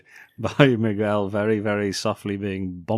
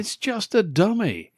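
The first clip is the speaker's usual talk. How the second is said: relieved high voice; 11 semitones higher, 4 dB louder than usual. Retold or imitated. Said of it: "she says".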